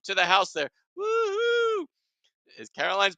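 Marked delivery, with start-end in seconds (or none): 1.0-1.9 high-pitched held whoop; high woo-hoo. Real, not acted. Host cheering friends in the chat, high whoop.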